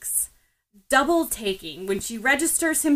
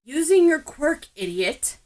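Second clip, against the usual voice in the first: louder snapped start, dropping on idiot; irritated scold; 6 semitones higher, 4 dB louder than usual. A narrator's reading. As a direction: bark it, then toss the insult. Bakugo scolds Ochako.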